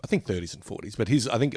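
normal talking voice

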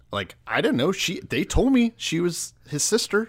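high, indignant sputtering protest; hammed-up panicked denial to God; mock outrage. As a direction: splutter like a wronged king caught off guard. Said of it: "Abimelech is pissed".

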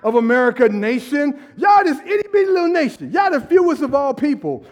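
high, loud and fast teasing rant; God mocking tiny Israel, shouting. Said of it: "He's like".

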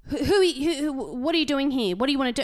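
high, loud indignant interrogation; loud high mock-outraged questioning; imitated angry old man. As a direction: mimic a nosy bossy neighbour. Old man confronts strangers.

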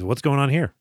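usual speaking voice

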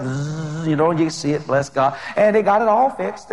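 normal talking voice

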